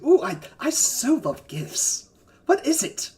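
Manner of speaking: high, drawn-out gushing; slow, high-pitched delight; delighted giant. Acted. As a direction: gush over it with eager curiosity. Giant delighted about a gift.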